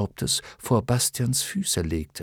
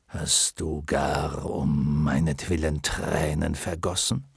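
lion voice; 7 semitones lower, 4 dB quieter than usual. Acted.

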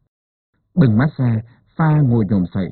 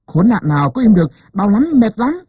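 high, strained groan of pain; pained moan; 9 semitones higher than usual. A narrator's reading. Wife in labour complaining to midwife, high strained moan. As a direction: let the pain pitch the voice up. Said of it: "cứ kêu".

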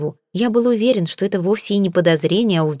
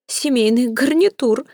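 higher, slow, tearful whimper; sobbing; 7 semitones higher than usual. Acted. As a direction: sniffle it out as if about to cry. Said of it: "почему-то всхлипнула миссис Флип".

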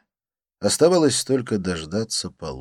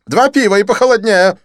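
high, loud, theatrical French friend; excited Didier; 13 dB louder than usual. Acted. Didier greeting his friend Lin, high excited gush.

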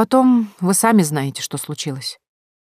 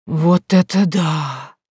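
slow, stretched wow of delight; drawn-out admiration; much slower than usual. Acted. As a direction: stretch it out, dazzled. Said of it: "протянула Кэнди с восхищением".

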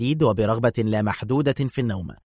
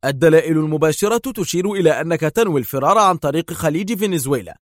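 high, loud eager argument; agitated; 6 semitones higher, 3 dB louder than usual. A narrator's reading. Yael explains his deduction to Adham, agitated. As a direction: lay out the theory heatedly. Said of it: "أجابه يائيل في انفعال".

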